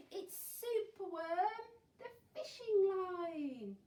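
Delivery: high, loud, drawn-out announcement; triumphant reveal. A narrator's reading. Teacher reveals the rescue to children, triumphant announcement. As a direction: announce the hero with a flourish. Story hero saves the day.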